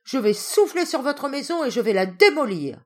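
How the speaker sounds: loud, high angry threat; furious wolf threatening the pigs' brick house. Acted. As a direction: bellow the threat to knock it down. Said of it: "hurla le loup".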